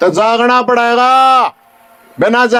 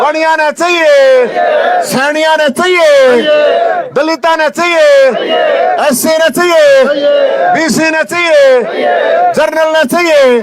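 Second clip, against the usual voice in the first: rousing crowd call; 4 semitones higher than usual. Real, not acted. Rally call and response with crowd.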